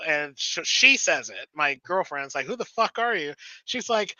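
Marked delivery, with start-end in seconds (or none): 2.5-3.3 high-pitched confrontation without shouting; mock outraged challenge; imitated indignant girlfriend.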